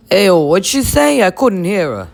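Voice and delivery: puzzled whine; bro voice. Acted. Boy who missed what Lisa said, whiny bro. Voicing one of the boys.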